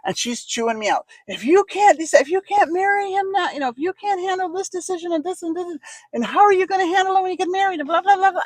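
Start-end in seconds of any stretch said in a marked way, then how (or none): 1.3-8.4 much higher, lecturing voice; scolding friend. Retold or imitated. Janella scolding her in the car about the engagement, high-pitched. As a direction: mimic a friend chewing her out.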